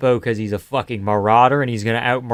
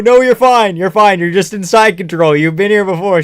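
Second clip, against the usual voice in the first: high, loud coaxing at the screen; talking the fighter through it; loud reassurance; 5 semitones higher, 7 dB louder than usual. Real, not acted.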